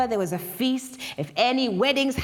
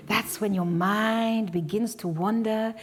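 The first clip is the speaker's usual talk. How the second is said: usual speaking voice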